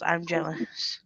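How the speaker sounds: ordinary talk, nothing marked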